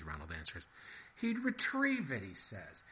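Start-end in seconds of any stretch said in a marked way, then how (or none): 1.1-2.2 much higher, disbelieving repeat; squeaky incredulous echo; incredulous mouse. A narrator's reading.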